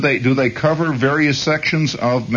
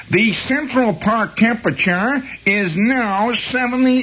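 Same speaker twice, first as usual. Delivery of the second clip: put-on slow, higher nasal-free Brooklyn accent recorded forecast; Brooklyn weather girl; 11 semitones higher than usual. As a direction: read the temperature like the Brooklyn phone girl. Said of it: "this great Brooklyn weather girl".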